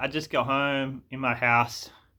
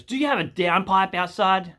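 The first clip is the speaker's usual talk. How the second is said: higher put-on tradie voice; mock tradie question, pitched up; imitated interrupting tradie; 6 semitones higher, 4 dB louder than usual. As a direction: cut in briskly as the tradesman. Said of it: "he just interrupts straight away".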